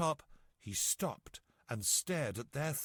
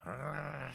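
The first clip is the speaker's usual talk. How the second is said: bear growl; 2 semitones higher, 4 dB quieter than usual. A narrator's reading. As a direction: growl through clenched teeth. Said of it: "she growled through her large and very sharp".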